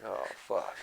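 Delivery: hushed dismayed curse. Retold or imitated. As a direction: drop it to a dismayed undertone. Retold dismayed reaction.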